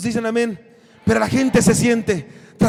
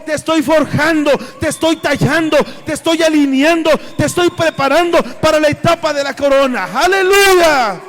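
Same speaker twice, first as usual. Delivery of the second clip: louder and louder, each phrase hammered; preaching at full force; 3 semitones higher, 7 dB louder than usual. Acted. Pastor building to a fiery shout for the church. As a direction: build the sermon to a roaring climax. Preaching climax, rising volume.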